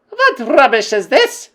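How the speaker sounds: high, loud, slow scornful shout; angry witch queen. Acted. Grand High Witch scolding a witch, angry and loud. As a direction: scorn the claim. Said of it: "shouted the Grand High Witch".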